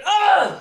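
sharp yelp of dismay; startled cry. Acted. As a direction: cry out as it drops. Drops hanky, cries out.

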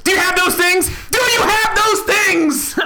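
shouted demand. Acted. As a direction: yell the question at him, then drag it out. Player demands loudly in character.

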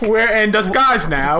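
slow, put-on Cockney accent. Disguised plumber explaining himself to friends, slow mock Cockney. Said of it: "why are you turning Cockney now".

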